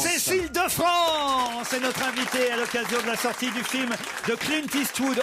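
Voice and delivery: big announcer voice, pitched high; loud announcement. Real, not acted. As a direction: proclaim the guest's name loudly. Host announces guest at full voice.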